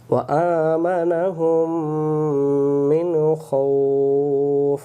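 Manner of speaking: very slow, melodic drawn-out recitation; Quran recitation. Shown as a demonstration. Teacher modelling the correct words for students, slow chant. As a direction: stretch মিন and জু'ইউ in a long chant. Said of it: "recites word as model".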